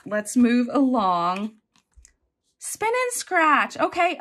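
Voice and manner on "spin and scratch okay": excited. Real, not acted. Excited unboxing.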